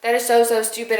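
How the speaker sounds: a frustrated reader's loud rant; exasperated outburst. Retold or imitated. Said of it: "And I'm just like".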